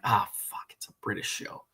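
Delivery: quiet, low reenacted groan of disappointment; mock dismay, said under the breath; muttered letdown. Viewer grumbling to himself on spotting a British show, deflated mutter.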